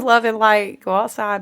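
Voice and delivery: ordinary talk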